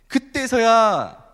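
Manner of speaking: slow, loud, high then; dramatic slow build; drawn out. Real, not acted. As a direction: stretch the word out for drama. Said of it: "dramatic slow emphasis".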